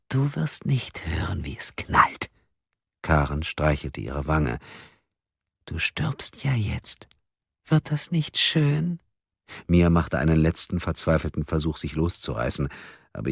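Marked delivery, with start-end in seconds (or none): 0.1-2.3 breathy whisper into her ear. Acted. Karen whispering into bound Mia's ear, menacing whisper. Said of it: "flüsterte eine Stimme ihr ins Ohr". 5.7-9.0 menacing whisper. Acted. Karen whispers threat in ear.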